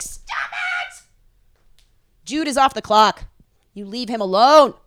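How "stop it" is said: exasperated shout. Retold or imitated. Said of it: "And we're like".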